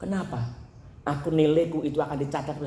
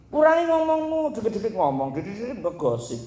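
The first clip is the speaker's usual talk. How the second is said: mock nagging voice; 6 semitones higher than usual. Voiced advice.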